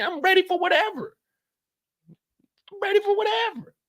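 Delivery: high, pumped-up voice of Drew; hyped-up Drew. Retold or imitated. Host voices Drew ready for whatever, high and pumped. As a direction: play Drew amped and fearless.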